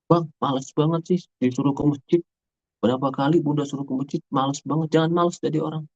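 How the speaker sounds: put-on a mother scolding her lazy boy. Shown as a demonstration. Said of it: "Itu kalimat itu". (ibu mengomeli anak dengan nada tinggi)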